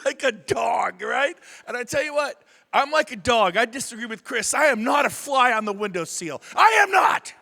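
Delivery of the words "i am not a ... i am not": shouted, insistent denial; emphatic protest. Real, not acted. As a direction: deny it loudly, topping it on the repeat. Loud protest against Chris's remark.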